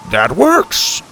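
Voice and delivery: high, loud approval; pleased surprise. A narrator's reading. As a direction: exclaim it brightly as grandpa. Grandpa pleased after stunned silence.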